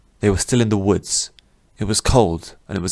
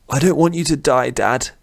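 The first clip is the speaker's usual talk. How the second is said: desperate plea; 7 semitones higher than usual. Acted. Son begging dying father.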